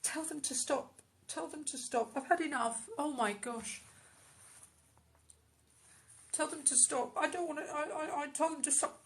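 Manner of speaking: rising distress, high and tight; high desperate plea. Acted. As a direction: beg them to make it stop. Channelling woman under family pressure.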